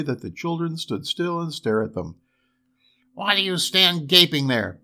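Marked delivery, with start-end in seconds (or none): 3.1-4.8 raised, scolding dwarf voice; angry dwarf cry. A narrator's reading. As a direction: snap at the gawkers as a cross dwarf. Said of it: "cried the dwarf".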